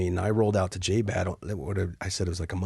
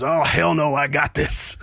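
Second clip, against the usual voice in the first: gung-ho refusal; 6 semitones higher, 6 dB louder, slower than usual. Retold or imitated. Brett refuses, jumps out.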